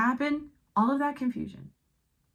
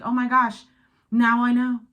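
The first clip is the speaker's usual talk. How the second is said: louder, higher, wide-eyed realization; inner child voice; 4 semitones higher, 7 dB louder than usual. Retold or imitated. Voicing the viewer's inner child, realizing the old pattern, higher and louder. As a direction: voice the inner child suddenly understanding. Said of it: "My inner child is like".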